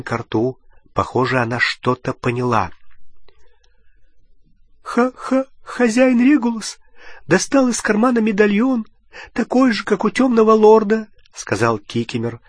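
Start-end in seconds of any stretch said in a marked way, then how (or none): 4.8-11.1 high, tearful elf voice, much higher; a sobbing old elf squeaking out his story; crying house-elf, pitched far up; weeping house-elf. A narrator's reading. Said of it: "Слёзы уже текли по обеим сторонам его рыльца".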